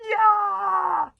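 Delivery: high, loud answering yell; yelled war cry. Acted. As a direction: yell it back at your leader. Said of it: "yelled ten of the boys back".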